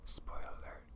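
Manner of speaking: ordinary talk, nothing marked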